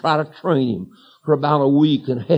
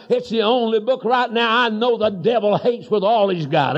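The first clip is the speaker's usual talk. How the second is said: ordinary delivery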